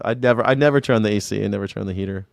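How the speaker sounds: ordinary talk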